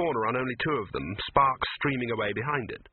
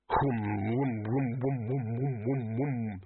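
humming whom. A narrator's reading. Vase humming sound.

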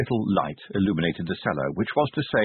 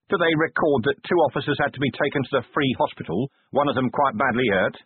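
brisk, irritated commander voice; Vimes, gruff commander; 8 semitones higher than usual. Acted. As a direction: grumble it out, quick and fast.

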